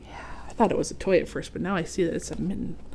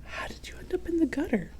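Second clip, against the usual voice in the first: hushed worried question; gentle whisper; 7 semitones higher, 6 dB quieter, faster than usual. Acted. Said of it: "said Otto".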